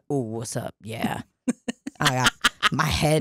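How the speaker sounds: impersonating a creep, hushed and low; creepy guy imitation. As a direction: mimic a sleazy guy's murmured come-on. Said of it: "this motherfucker literally was like".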